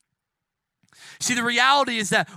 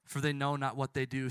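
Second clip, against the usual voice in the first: hushed forgiveness; 6 semitones lower, 6 dB quieter than usual. Acted. Jesus forgiving us from the cross, hushed. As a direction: speak it softly over them. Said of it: "he says that also over us".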